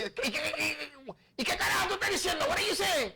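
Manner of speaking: high, loud, rapid Spanish-to-English tirade; screaming mother. Retold or imitated. Mother catching her kid laughing, imitated angry scream. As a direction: imitate a mom stuttering with rage, then yelling. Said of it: "my mother screaming at my brother".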